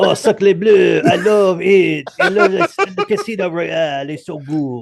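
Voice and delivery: slow, high, hammed-up French accent. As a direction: ham it up like a French chef. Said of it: "trying to do my Denny Villeneuve impression".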